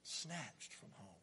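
soft, slow repeat; whispered echo; hushed echo. Acted. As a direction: let it land quietly.

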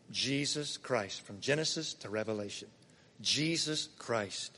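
soft, slow and low, almost a prayer; hushed reverence. Real, not acted. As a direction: let the name sink in quietly. Reverent answer after a question.

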